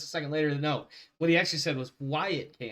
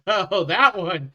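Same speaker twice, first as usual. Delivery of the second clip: surprised; 4 semitones higher, 9 dB louder, slower than usual. Real, not acted. Sudden recognition.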